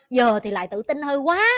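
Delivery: ordinary delivery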